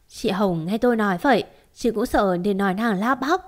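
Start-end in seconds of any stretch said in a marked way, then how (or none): none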